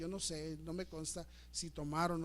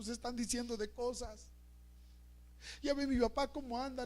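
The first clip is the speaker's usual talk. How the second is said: a hint of a desperate boy, higher; lightly voiced young man pouring out his trouble; 8 semitones higher than usual. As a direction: plead like a distraught young man. Estaba desesperado.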